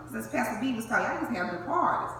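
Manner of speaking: ordinary talk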